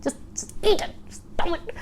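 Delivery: hushed, breathless whisper; whispered scolding. Retold or imitated. Wife hushing her fussy husband at the elegant tea, whispered. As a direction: whisper it sharply so no one hears. Hissing at husband in posh tearoom.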